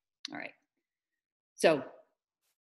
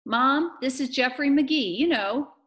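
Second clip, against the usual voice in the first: put-on louder, higher girl's voice; eager introduction; 8 semitones higher, 7 dB louder than usual. Amanda introduces Jeffrey to her mother, eager introduction. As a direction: introduce him insistently.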